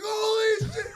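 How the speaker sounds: very high-pitched mock shriek; acted horrified shout, way up high; mock crowd scream. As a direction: imitate a shocked bystander screaming. Crowd screams at near miss.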